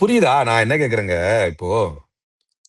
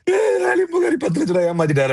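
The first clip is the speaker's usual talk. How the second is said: hammed-up crying shout, pitched much higher; mock wailing. Mocking a crime inspector who cries over being cheated, in a high crying wail. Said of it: "அப்படின்னு அழுதுகிட்டு இருக்கிறீங்க".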